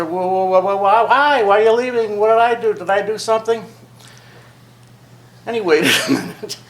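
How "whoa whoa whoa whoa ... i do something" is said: higher, imitated pleading child; eleven-year-old boy. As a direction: lightly voice a kid questioning a departing father. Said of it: "eleven years old, and I said".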